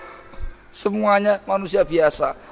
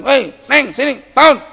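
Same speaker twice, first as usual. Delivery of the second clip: sharp higher call to wake her up; impatient husband's call; 8 semitones higher, 3 dB louder than usual. Retold or imitated. Husband snapping at his wife to get up.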